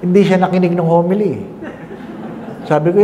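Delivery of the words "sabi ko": ordinary talk, nothing marked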